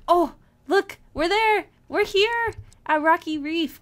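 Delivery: thrilled to have got there; excited discovery. Real, not acted. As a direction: call out the arrival with delight. Arriving at the reef.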